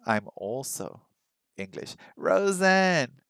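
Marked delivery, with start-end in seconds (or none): 2.2-3.2 drawn-out, higher-pitched call of the name; excited greeting. Real, not acted. Host greets viewer Rosen who just arrived, excited greeting. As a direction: call out a long, happy hello.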